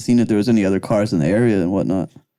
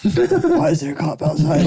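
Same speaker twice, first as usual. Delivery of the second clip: laughing; 3 semitones higher than usual. Retold or imitated. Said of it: "me and Morgan both were like".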